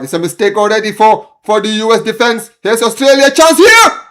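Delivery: panicking as the danger builds; alarmed excitement. Real, not acted. Streamer watching his team concede a chance, alarmed rising shout. Australia attacking, US defense error.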